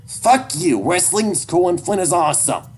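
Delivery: put-on high, loud furious kid; high-pitched angry outburst; defiant yell. A narrator's reading. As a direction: shout back at your father. Child screaming back at father.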